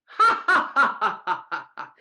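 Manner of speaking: mocking belly laugh that starts high and dies away; the foreman laughs off the bear's excuse; loud laughter. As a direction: laugh right in his face. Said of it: "The foreman laughed very loud".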